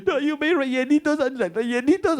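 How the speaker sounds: high, wobbling tearful voice; hammed-up crying. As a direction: plead through mock tears. Crying before the chef.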